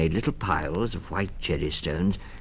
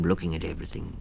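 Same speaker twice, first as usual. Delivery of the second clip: usual speaking voice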